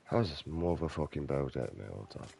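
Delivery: ordinary talk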